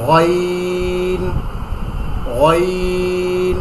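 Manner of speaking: গইন held long from the throat; throaty ghain. Shown as a demonstration. Teacher showing students the letter ghain, slow. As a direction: stretch গইন slowly from the throat. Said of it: "এখানে হাত দিয়ে".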